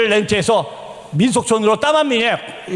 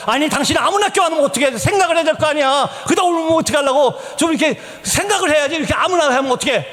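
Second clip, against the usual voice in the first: high-pitched, exasperated telling-off; acted marital reproach, pitched up; retold angry scolding; 8 semitones higher than usual. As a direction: re-enact scolding a spouse. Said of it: "집사람한테 화를 냈습니다".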